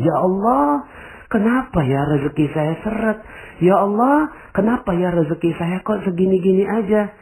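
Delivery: high, pleading lament; whining complaint. Retold or imitated. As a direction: moan to God about money. Jamaah mengeluh soal rezeki. (mengeluh dengan suara tinggi merengek)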